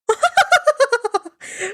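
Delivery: high, eerie god's laugh. Acted. Hermes laughs unseen in the trees, high and odd. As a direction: cackle down from the trees. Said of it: "laughter coming from the trees".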